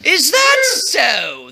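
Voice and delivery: slow, drawn-out royal musing; pondering king. A narrator's reading. King hearing the aide's rumor, slow pondering. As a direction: mull it over with suspicion. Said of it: "the king replied, rubbing his chin".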